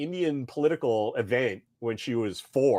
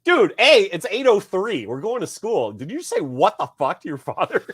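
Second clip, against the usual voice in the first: higher-pitched, incredulous dad telling-off; mock parental scolding; 7 semitones higher, 3 dB louder than usual. Father retells scolding Walker before school, mock indignation. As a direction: retell a dad's indignant ticking-off. Acts out scolding his child.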